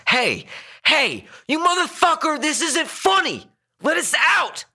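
very high, panicked angry yelling; sudden fury. A narrator's reading. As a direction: explode at the driver holding you captive. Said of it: "His emotions turned quickly then".

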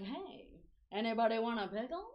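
put-on old-school gangster offer, rising at the end; playful mock old-time voice; old-timey TV voice. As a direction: imitate old television talk, lilting up on the last word. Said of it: "So that's what that voice is to me".

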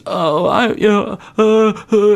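put-on high, whimpering child about to cry; high mock child whimper, words breaking off; whimpering kid. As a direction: play a caught kid starting to whimper. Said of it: "and starts to cry".

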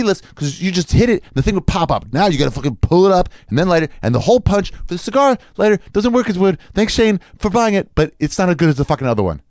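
high-pitched, exasperated griping; worked-up rant. Real, not acted. Comic griping to the audience about his stolen lighter, exasperated rant. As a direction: rant indignantly, pitch climbing as you go.

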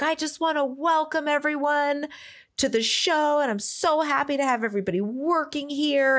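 high-pitched, earnest welcome speech; gushing welcome. Retold or imitated. Imitating the star welcoming show staff, gushing welcome. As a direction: lift the pitch, imitate a gracious host.

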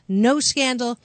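ordinary delivery

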